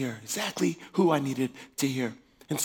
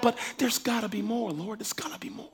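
fading from a soft plea to a whisper; longing prayer; 5 semitones higher, 7 dB quieter than usual. Retold or imitated. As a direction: let the prayer die away to a breath. Praying for more, falling to whisper.

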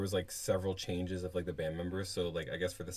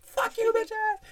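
very high screeching yell, much louder; shrieking; 9 dB louder, much slower than usual. Pretend. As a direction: screech it at the top of the voice. Playful sign-off cursing.

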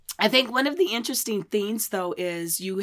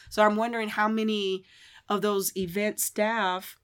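ordinary talk, nothing marked

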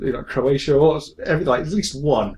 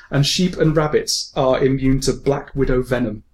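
normal talking voice

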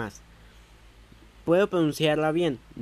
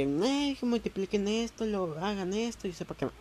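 high, softer mocking teacher voice; 7 semitones higher, 4 dB quieter than usual. Mocking his primary school teacher's easy instructions, high and light. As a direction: put on a higher voice, mimic the teacher.

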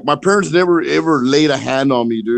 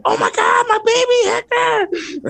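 mock coach shrieking high and slow over her baby; fussing coach; slower than usual. The coach running over to fuss over her hurt player, shrill high mock panic.

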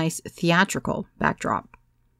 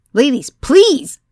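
slow, higher and louder plea stressing "please"; imploring shout; 9 semitones higher, 9 dB louder, much slower than usual. A narrator's reading. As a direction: beg the room loudly. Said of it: "I implored".

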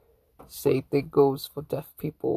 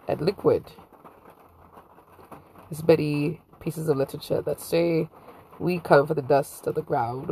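ordinary delivery

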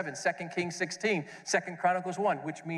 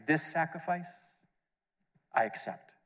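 voice of God. Retold or imitated. Voice from heaven at the baptism, solemn. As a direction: quote the Father, solemn and unhurried. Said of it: "the voice came down saying".

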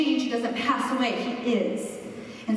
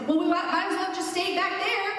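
high whiny mock complaint; a mocking voice of the doubters; whiny Israelites; 9 semitones higher than usual. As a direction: pitch it up like grumbling doubters.

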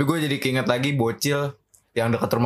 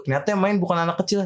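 usual speaking voice

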